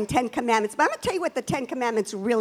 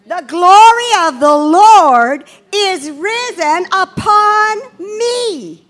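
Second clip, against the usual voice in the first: slow, declaring repeat of the verse; louder call and response; 5 semitones higher, 9 dB louder, much slower than usual. Shown as a demonstration. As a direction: proclaim it back, louder and slow. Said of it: "Say that with me".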